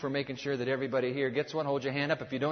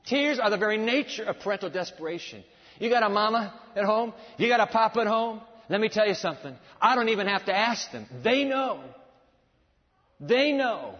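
raised, emphatic pulpit voice; preaching; 6 semitones higher, 4 dB louder than usual. Real, not acted. Preacher pressing congregation about parents' tears, preaching. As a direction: drive it home to the congregation.

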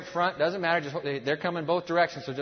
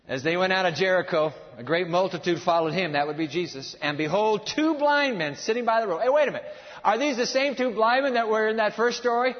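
raised pulpit reading, climbing to questions; animated preaching; 4 semitones higher, 5 dB louder than usual. Real, not acted. Preacher reading Matthew to congregation, animated preaching. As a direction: read it out with mounting energy. Preaches the blind men story.

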